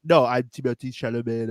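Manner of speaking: low mock-French delivery; mock French accent. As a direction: imitate a man speaking schoolboy French. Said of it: "speaks like fucking high school French".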